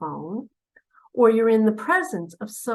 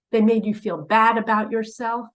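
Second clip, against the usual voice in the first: ordinary talk, nothing marked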